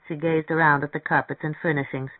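ordinary delivery, nothing marked

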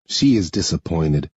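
ordinary delivery

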